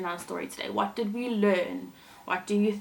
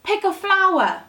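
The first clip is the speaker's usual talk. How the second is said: higher, louder call; 10 semitones higher, 8 dB louder than usual. Acted. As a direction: call out to her from the air. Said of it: "Swallow called out to her".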